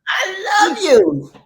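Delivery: sobbing it out, high and loud, slow; real tears spilling into the words; crying I love you. Real, not acted. Answering Taz's thanks, crying out her love. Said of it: "I'm not gonna get crying".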